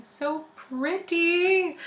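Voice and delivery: slow, high, stretched-out so pretty; drawn-out gush. Real, not acted. Showing tattoos to listeners, slow high gush. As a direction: linger on pretty as if swooning.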